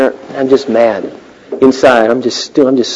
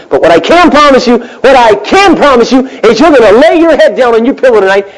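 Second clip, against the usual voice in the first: emphatic promise; 10 semitones higher, 3 dB louder than usual. Real, not acted.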